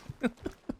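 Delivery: soft airy laugh under the breath; a hushed little laugh; breathy giggle. Real, not acted. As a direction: let a quiet laugh slip out. Laughs after scolding chat.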